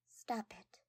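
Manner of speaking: "Stop it." whispered and falling. Acted. Shy girl embarrassed by her friend's praise, whispered. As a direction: breathe "Stop it." barely voiced.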